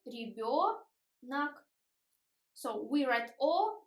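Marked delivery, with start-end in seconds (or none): 0.1-1.7 slow "ребёнок" in parts, ri-BYO, nak; syllable by syllable. Shown as a demonstration. Teacher showing the vowels, slow syllabic pronunciation. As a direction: stretch "ребёнок" out, pausing before the last syllable.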